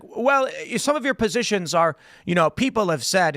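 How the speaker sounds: hammed-up evasive reporter; flustered journalist. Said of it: "And he's like".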